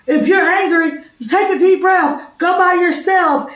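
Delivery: lightly voiced child reciting the steps; imitating a child. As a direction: sing it out like a small pupil reciting. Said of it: "they'll say".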